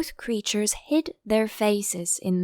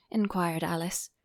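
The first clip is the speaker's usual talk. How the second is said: ordinary delivery, nothing marked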